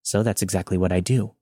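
ordinary delivery

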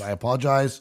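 ordinary talk, nothing marked

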